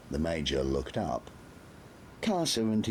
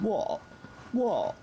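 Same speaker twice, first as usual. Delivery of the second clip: much higher, cocky snapping lad; defiant. A narrator's reading. Nobby to the staring soldiers, defiant. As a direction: challenge the staring men. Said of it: "he said defiantly".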